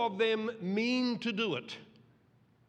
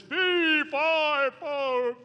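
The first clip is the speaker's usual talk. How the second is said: loud, high giant's roar; hammed-up giant call; booming giant; 9 semitones higher, 8 dB louder, much slower than usual. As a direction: bellow it like a fairy-tale giant. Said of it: "he pops out every morning and says".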